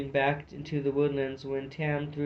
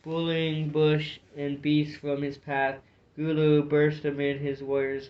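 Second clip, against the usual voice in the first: ordinary delivery, nothing marked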